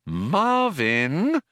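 high, drawn-out warning call. A narrator's reading. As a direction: say the name as a caution. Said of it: "warned Trillian".

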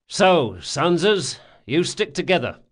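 brisk, lightly voiced ringleader; a touch of a commanding schemer; gang leader giving orders. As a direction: lay out the plan, lightly in command. Locke gives orders.

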